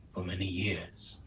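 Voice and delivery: unvoiced whisper. Lair dweller whispers to the party, hushed. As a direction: breathe the words out with no voice.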